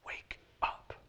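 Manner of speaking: an unvoiced whisper from God; hushed, urgent whisper; whispered wake-up call. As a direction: breathe it right into their ear. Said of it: "God just grabbing a hold of you and be like".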